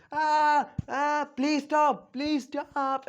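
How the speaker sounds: high, drawn-out mock wail; imitated crying out. Naruto begs as hot oil is poured, imitated wailing. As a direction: plead in an acted, pained high cry. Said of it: "he's crying out loud".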